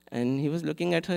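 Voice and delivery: usual speaking voice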